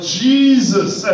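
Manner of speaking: a high, loud cry of the name. Pretend. A person in trouble crying out to God, a high loud call. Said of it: "because they cry out".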